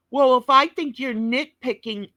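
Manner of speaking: ordinary talk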